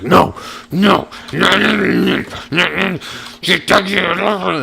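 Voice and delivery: high, loud, panicked string of nos; frantic refusal. Retold or imitated. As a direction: keep refusing, high and loud, as if she's snapping. Angry cop refuses to calm down.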